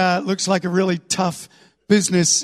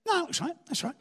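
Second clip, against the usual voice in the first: soft, higher-pitched casual reply; unbothered murmur; 9 semitones higher, 10 dB quieter, faster than usual. Acted. God glancing down from behind his paper, unbothered. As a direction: brush it off quietly.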